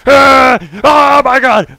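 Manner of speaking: loud, high squeal of joy; overjoyed shriek; excited scream. Real, not acted. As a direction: scream with delight.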